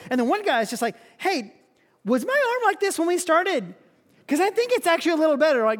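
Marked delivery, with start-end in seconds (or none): 1.2-5.6 surprised and hopeful; one leper imitated noticing his arm is better; noticing healing.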